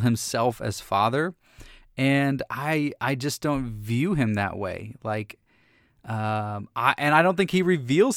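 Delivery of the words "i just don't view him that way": ordinary talk, nothing marked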